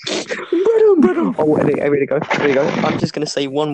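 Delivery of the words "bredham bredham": "Bredham" sung out high and stretched, mocking; mock mispronunciation. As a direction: call out "Bredham" in a high teasing voice. Imitating Ethan's mispronunciation.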